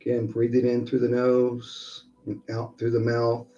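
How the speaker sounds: soft, unhurried breathing guidance; guided meditation. Acted. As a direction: ease them into each breath.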